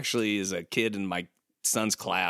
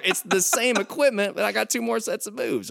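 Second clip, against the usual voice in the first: laughing, high indignant quote; 9 semitones higher than usual. Retold or imitated. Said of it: "It's like".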